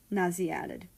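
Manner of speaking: usual speaking voice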